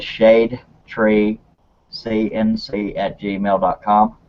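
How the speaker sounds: slow, spaced-out dictation of an email; saying it slowly. Real, not acted. Host reading an email for viewers to write down, slowly. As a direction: read it out slowly, piece by piece. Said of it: "I will say this slowly so people can write it down".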